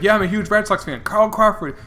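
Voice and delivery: imitating a loud, high-pitched fan's rant; megaphone heckler. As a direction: pitch it up and call out the complaint. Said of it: "make your voice really loud".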